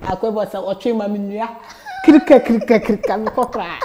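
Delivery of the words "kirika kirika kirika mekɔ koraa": loud laughing kirika kirika; laughing running sound. Retold or imitated. Retelling how she ran off, laughing loudly. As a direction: mimic the running feet while laughing.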